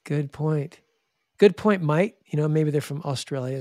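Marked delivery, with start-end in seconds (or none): 1.4-2.2 louder, higher Australian "mate"; punchy Aussie accent. Retold or imitated. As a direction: imitate an Australian bloke. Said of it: "They got the Aussie accent".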